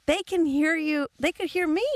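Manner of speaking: high, insistent protest; mock exasperation. Passenger telling yelling friend they can hear, exasperated protest.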